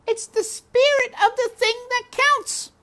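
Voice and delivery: very high, loud repeat; princess shouting. A narrator's reading. Princess shouting back at Mig, very high and loud. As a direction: shout it so she hears. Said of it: "shouted P".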